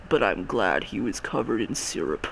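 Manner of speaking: very low, soft impersonation of the co-host; creepy quoted voice. Retold or imitated. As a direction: drop right down into an insinuating low voice. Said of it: "when you say it in that voice".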